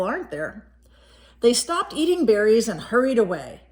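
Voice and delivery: plain reading voice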